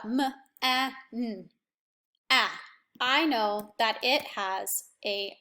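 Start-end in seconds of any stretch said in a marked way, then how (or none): 0.1-2.5 man broken into M, a, n, then the short a held alone; sounding out. Shown as a demonstration. Teacher sounding out man letter by letter for the class. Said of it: "listen to it out loud".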